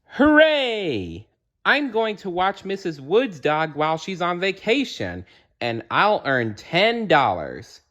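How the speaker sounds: high, bright cheer. Acted. Arthur telling family about his job, cheering. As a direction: cheer like a kid who won.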